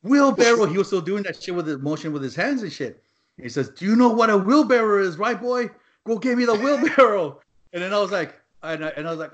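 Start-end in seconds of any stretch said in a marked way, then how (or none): 0.0-0.7 loud, high one-word order; choppy command. Retold or imitated. Foreman repeating his order to a worker, loud and high. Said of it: "real shoppy the way he's telling me". 3.7-7.4 high, loud, bossy questioning; choppy command. Retold or imitated. Foreman quizzing a confused worker, high choppy command. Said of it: "real shoppy the way he's telling me".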